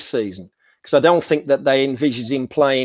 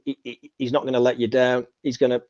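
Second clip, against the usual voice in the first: ordinary talk, nothing marked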